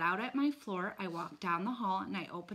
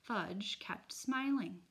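usual speaking voice

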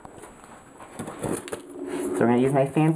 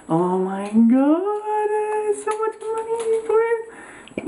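much higher, very slow stretched squeal; awed squeal; much slower than usual. Real, not acted. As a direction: drag it out in amazement. Awed at pricey lens.

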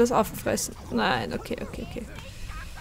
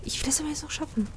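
whispering; 4 semitones higher, 8 dB quieter, faster than usual. Real, not acted. Whispered resolve while playing.

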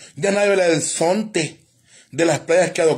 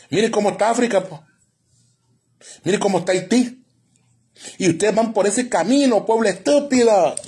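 preaching; 5 semitones higher than usual. Acted. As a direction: thunder like a preacher at a sinful nation. Preaches doom at the people.

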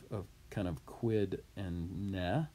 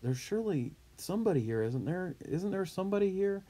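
hopeful doubt; 10 semitones higher, faster than usual. Shown as a demonstration. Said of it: "is kind of like".

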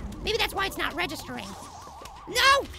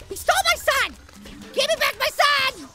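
higher, louder angry protest; frustrated yell; 5 semitones higher, 3 dB louder than usual. Real, not acted. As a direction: shout at the thief to give it back. Zombie steals his sun.

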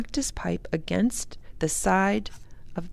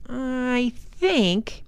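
slowly, thinking it over; higher, drawn-out hesitant start; slow, thoughtful; 6 semitones higher than usual. A narrator's reading. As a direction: draw the words out as you think. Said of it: "he said slowly".

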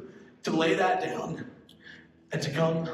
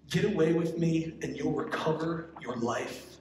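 slow, unhurried reading with long pauses. Shown as a demonstration. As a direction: let each line sink in before the next. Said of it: "I'm gonna read this slowly".

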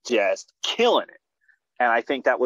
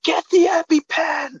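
high-pitched shouted emergency order; imitated urgent shout; 4 dB louder than usual. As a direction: shout like an alarmed mom calling for help. Mom's urgent order in retold emergency.

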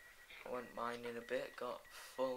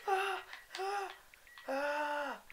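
high loud screams; repeated high loud screams; 7 dB louder, much slower than usual. Real, not acted. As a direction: scream as it attacks. Mob attacks.

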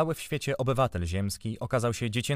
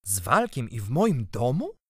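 irritated; 2 semitones higher, 4 dB louder than usual. A narrator's reading. Uncle hearing of the boy and his servant, irritated. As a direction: stretch it out in growing indignation. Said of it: "Zirytował się".

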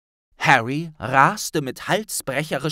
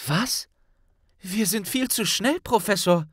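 scared protest; 8 semitones higher than usual. A narrator's reading. Harry protests.

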